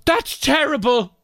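high, slow mock old lady complaint; imitating elderly lady. Old lady complaining to the lad at the till, high and slow. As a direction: drag it out high like a cranky old woman. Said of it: "And she goes".

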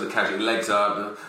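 normal talking voice

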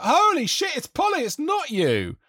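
high, slow, astonished; shocked wife voice. Retold or imitated. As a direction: gasp it out in disbelief. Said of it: "she was like".

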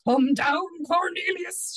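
loud, high shouted command; thundering. Acted. Angry wife calling her husband down from the apple tree, thundering. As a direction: thunder it up at the culprit. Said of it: "she thundered".

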